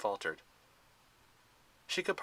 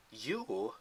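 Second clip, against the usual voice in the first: softer, disdainful single word; distaste; 6 dB quieter than usual. A narrator's reading. Zoe recognizing Thalia, soft disdainful question. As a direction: look down on her with contempt. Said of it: "she said with distaste".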